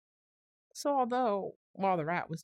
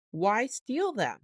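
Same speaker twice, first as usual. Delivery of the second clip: slow, louder, each word pressed; slow emphatic question; 4 semitones higher, 6 dB louder, much slower than usual. A narrator's reading. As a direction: drag out the question in disbelief. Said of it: "talking so seriously".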